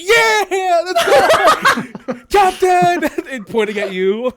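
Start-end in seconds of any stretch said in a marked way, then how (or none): 0.0-3.1 loud, high rowdy cheering; crowd cheer. Acted. Game master plays crowd hailing captain, shouting high. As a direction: whoop for the captain at full voice.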